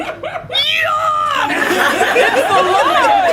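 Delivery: panicked scream while fleeing; man screaming. Acted. Man screams at the talking doll, high and loud. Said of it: "The man screamed".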